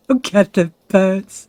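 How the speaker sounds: laughing as he points out the birds. Real, not acted. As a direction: chuckle through it, slowly.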